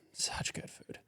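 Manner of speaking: whispered aside about the food. Real, not acted. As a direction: breathe it out in a whisper.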